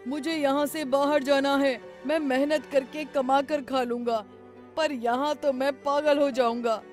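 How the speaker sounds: high, loud pleading cries; desperate shouting. Acted. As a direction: shout for the holy man to free you. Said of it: "Ramu जोर जोर से चिल्लाने लगा".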